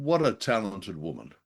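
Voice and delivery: normal talking voice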